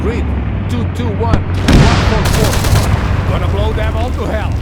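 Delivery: loud battlefield radio call; shouted radio call. Acted. Calling in strike over combat.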